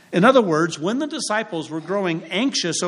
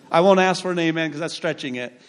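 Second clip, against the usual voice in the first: ordinary delivery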